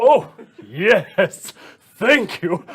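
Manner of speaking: loud, high gush of thanks; delighted fan. Acted. Sandier thanks Gretty, overjoyed and loud. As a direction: burst out with thrilled gratitude.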